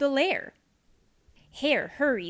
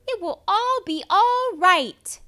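high, loud reassuring friends; high cheery chorus; 9 semitones higher, 8 dB louder than usual. Acted. Friends hugging Bear, high and loud reassurance. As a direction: lift them up brightly.

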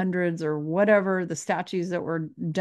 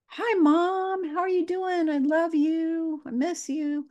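put-on much higher, slow, sugary child-to-mom voice; loving, sweet; 10 semitones higher, slower than usual. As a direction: coo it lovingly up high, drawn out. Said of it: "Are you addressing them lovingly?".

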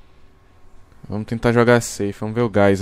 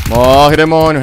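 loud, high, fast war cry; battle shout; 4 semitones higher, 9 dB louder, slower than usual. Real, not acted. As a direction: scream it while firing. Shooting at enemies.

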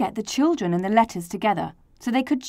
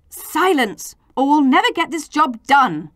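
raised, higher exasperated order; grumbling; 6 semitones higher, 6 dB louder than usual. A narrator's reading. Grown-ups quieting the quarrelling letters, exasperated grumble. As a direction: scold with loud impatience. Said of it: "The grown-ups grumbled".

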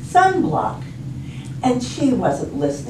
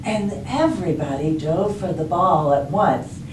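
usual speaking voice

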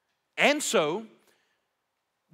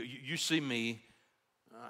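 normal talking voice

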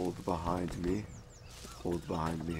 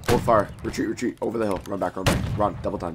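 frantic retreat order; 2 semitones higher, 8 dB louder than usual. Real, not acted. Ordering retreat under fire.

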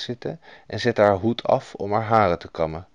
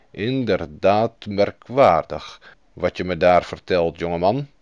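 lower, measured royal voice, lightly done; a king weighing the news; thoughtful king; 3 semitones lower than usual. A narrator's reading. King answers the goose boy, low and considered. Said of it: "zei de koning".

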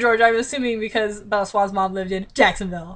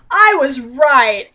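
slow, louder gloating; triumphant; 2 semitones higher, 7 dB louder, much slower than usual. Real, not acted. Reader to viewers, gloating about the prediction. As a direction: savour the win.

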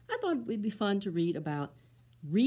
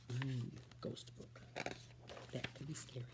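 softer eerie whisper; spooky whisper; 7 semitones lower, 14 dB quieter than usual. Acted. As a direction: hush to build suspense. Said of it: "narrator spooky whisper".

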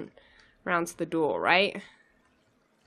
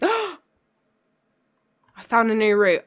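gasp of surprise; 6 dB louder than usual. Real, not acted. Gasp at finding a route.

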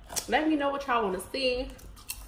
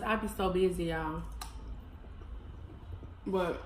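chewing while talking; biting a crunchy snack; mouth full; 4 semitones lower, 6 dB quieter than usual. Real, not acted. Seen 3: bites green snack at 0.2-1.7, then chews with full cheeks.

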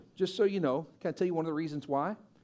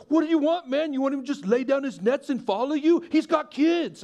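higher and louder, mock-scolding; exasperated friend; 8 semitones higher, 8 dB louder than usual. Pastor imitates friend Shane teasing him, loud and exasperated.

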